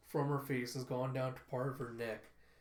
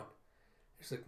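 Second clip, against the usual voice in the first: ordinary talk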